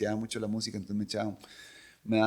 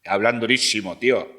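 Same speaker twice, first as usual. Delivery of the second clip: put-on projected Spaniard accent; 7 dB louder than usual. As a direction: do a booming forward-placed Spain accent. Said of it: "los españoles impostan la voz".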